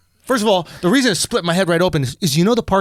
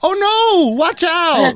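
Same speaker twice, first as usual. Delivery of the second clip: high, drawn-out mock warning; girlfriend's warning; 9 semitones higher, much slower than usual. Girlfriend warning him about the pole, high mock alarm.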